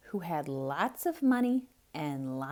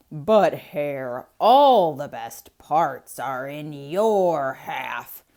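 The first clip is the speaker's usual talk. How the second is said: loud, slow, aggrieved bear voice; a tricked bear protesting to Hare; complaining bear; 3 semitones lower, 11 dB louder, much slower than usual. Acted. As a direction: grumble it out slowly, feeling cheated.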